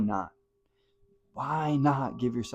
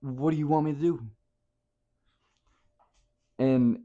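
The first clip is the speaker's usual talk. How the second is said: ordinary delivery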